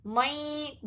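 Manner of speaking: مَیں with the noon hummed through the nose; two-beat ghunna. Shown as a demonstration. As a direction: hold the nasal in مَیں for two counts. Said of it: "ناک میں سے آواز نکالنا".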